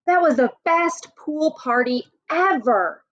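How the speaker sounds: put-on high, thrilled child voice; excited kid. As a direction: gush about the party like a happy kid. Kid character raves about party.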